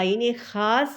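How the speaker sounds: usual speaking voice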